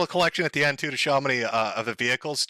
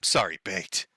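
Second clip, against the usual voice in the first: ordinary delivery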